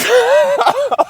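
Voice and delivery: squeaky giggle at own joke; high giggle. Real, not acted. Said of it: "it's funny".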